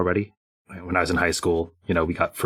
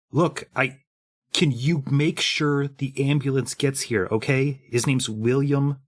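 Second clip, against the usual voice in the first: higher, halting appeal; anxious pleading; 6 semitones higher than usual. Acted. Scott backing away with hands up, anxious pleading. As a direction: plead carefully, a touch panicked.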